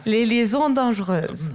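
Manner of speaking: title said with a French accent; French accent on the title, on cue. Shown as a demonstration. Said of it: "pueda usar su acento francés".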